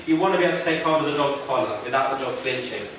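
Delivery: ordinary delivery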